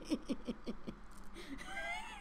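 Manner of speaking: breathless high-pitched giggle; helpless squeaky laugh; high squealing laugh. Real, not acted. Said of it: "laughing hard".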